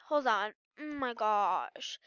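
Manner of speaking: ordinary talk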